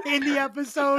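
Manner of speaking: laughing through the words. Real, not acted. Host laughing while telling of his embarrassing podcast slip. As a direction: crack up while confessing.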